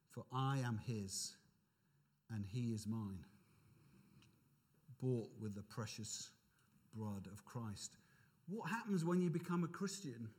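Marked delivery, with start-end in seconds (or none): 0.1-8.0 reverent hymn reading. Acted. Preacher recites the hymn's closing lines, hushed and reverent. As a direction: let each phrase settle softly, low.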